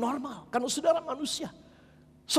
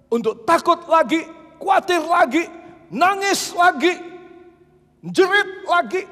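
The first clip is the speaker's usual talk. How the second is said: louder and higher with each item; rising preaching; 7 semitones higher, 5 dB louder than usual. Acted. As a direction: build the list like a rising alarm. Building list at full force.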